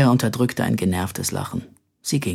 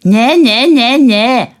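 high, loud mimicry of an old woman's squawk; mocking squawky voice; 11 semitones higher, 9 dB louder, slower than usual. A narrator's reading. Simpel mocking the great-aunt, squawky. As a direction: ape her squawking voice. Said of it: "äffte Simpel ihre quäkende Stimme nach".

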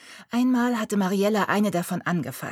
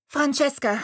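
put-on higher girl's voice, a bit louder; young girl; 8 semitones higher, 4 dB louder than usual. A narrator's reading. Girl explains to Mariella her kitten is a baby. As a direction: speak as the worried prim girl.

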